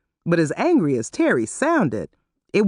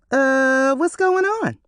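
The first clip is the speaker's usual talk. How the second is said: mock innocent; 9 semitones higher, slower than usual. A narrator's reading. Yvette faking innocent puzzlement.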